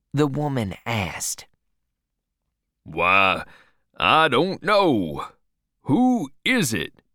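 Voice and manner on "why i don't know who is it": put-on low, startled old husband; be caught off guard; surprised old man. A narrator's reading. Old man seeing a stranger in his house, low and surprised. Silas puzzled.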